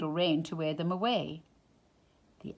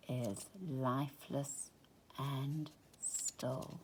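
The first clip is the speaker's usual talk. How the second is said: hushed; 5 semitones lower, 12 dB quieter, slower than usual. A narrator's reading. Lifeless still moon.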